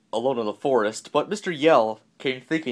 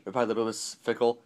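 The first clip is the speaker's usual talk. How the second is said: ordinary delivery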